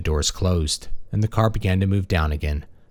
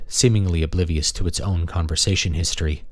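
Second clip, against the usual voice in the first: plain reading voice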